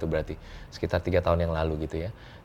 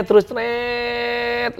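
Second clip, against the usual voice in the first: high, long-held "treeet"; drawn-out zip effect; much slower than usual. Retold or imitated. Guest skipping fifteen years in his story, drawn-out sound effect. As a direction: stretch out a comic fast-forward noise.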